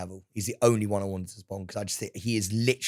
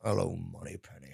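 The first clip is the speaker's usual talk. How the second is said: lower, softer Bond line out the side of the mouth; 6 semitones lower, 10 dB quieter than usual. Retold or imitated. Both doing Bond, talking out the side of mouth. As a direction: murmur it sideways, mock suave. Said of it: "Why are we talking out the side of our mouth".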